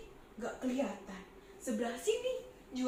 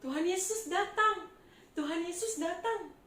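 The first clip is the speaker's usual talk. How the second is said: higher, louder mock crowd call; 5 semitones higher, 3 dB louder than usual. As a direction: call out the news to the whole street. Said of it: "Orang teriak-teriak".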